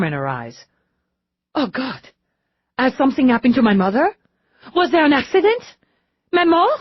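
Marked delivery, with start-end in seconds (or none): 1.5-6.7 high, loud rushed panic; sudden alarm. A narrator's reading. Lisette suddenly fearing for her mother, panicked. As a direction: fear the worst for your mother.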